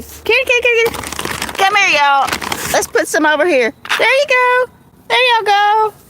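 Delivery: high-pitched coaxing call to kitties; calling the cats. Real, not acted. Owner calls kitties over to food, high-pitched pet talk.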